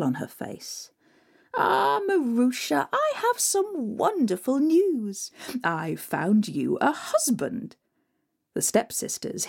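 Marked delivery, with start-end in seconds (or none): 1.6-7.8 slow, sly high voice; sly stepmother. Acted. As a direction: draw it out slyly. Stepmother announcing scheming news.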